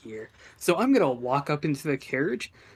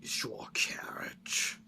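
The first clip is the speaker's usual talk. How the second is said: demonic voice; 7 dB quieter than usual. Acted. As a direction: whisper like a demon making demands. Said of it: "speak in my demonic voice".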